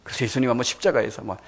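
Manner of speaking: ordinary delivery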